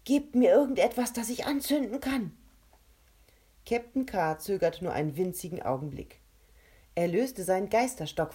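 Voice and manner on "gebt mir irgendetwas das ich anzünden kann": loud, higher, pressing plea; urgent demand. Acted. Kovac calling to his friends for something to burn, loud and urgent. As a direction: demand it right now, no time left.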